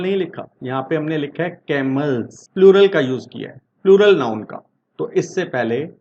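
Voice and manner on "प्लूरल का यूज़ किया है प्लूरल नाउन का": "Plural" said loud and punched, with a fast run-up; loud emphasis on "plural"; stress on plural. Shown as a demonstration. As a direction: hit the word "plural" hard to make the point. Teacher stresses the key word.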